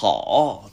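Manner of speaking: 好 stretched long, like a groan; drawn-out groaned 好. Shown as a demonstration. Teacher answers learner's greeting, 好 groaned out long. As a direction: draw 好 out in one long swoop. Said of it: "うなってましたね".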